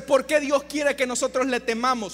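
ordinary delivery

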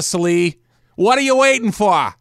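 loud, high, slow Boston tough-guy bark; Boston accent. Pretend. Host heckling his co-host in a loud Boston accent. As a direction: go full Boston, needling your buddy. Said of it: "do the stupid accent".

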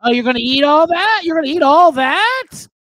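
hammed-up snooty relative mocking your plate; nosy aunt sneering at a full plate; mock food-shaming aunt. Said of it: "You gotta play".

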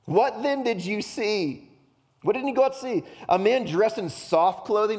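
high, loud, pointed questioning; a little angry. Acted. Jesus questioning the crowd about John, angry challenge. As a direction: press the crowd with exasperated questions. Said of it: "He's a little angry".

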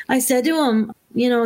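usual speaking voice

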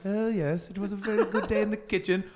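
high, mannered posh English voice; posh lord. Shown as a demonstration. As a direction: play a genteel lord, amused. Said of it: "like, posh lord".

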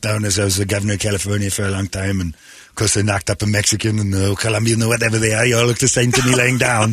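comic Arnold impression, Austrian accent; Arnold Schwarzenegger Austrian accent. Former governor tells of California, Arnold-style Austrian accent. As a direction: ramble on in a put-on Arnold accent. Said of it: "have to do the accent".

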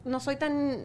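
normal talking voice

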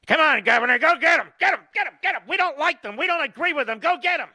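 high, loud, egging-on voice; mocking media cheerleader. Host mocking the media cheering the governor, high and loud. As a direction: ham up a cheering fan urging the governor on. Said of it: "Now listen to the media".

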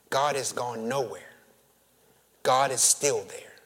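normal talking voice

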